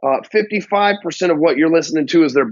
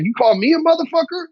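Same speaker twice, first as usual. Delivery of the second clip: high-pitched offended challenge, climbing at the end; bad-mood indignation; 10 semitones higher than usual. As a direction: act out taking it as an insult. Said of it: "they could be in a bad mood".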